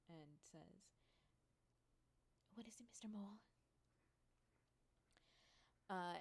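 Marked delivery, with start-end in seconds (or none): 2.5-3.4 higher, breath-edged query at an interruption; lightly annoyed at being interrupted; perturbed boss, voice lifted a little; mildly perturbed. Acted. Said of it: "perturbed at the interruption".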